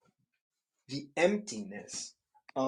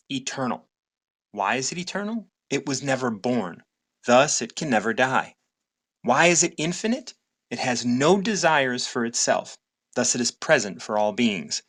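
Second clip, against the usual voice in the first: reading aloud; 7 dB louder than usual. Acted. Reads the Tao passage aloud.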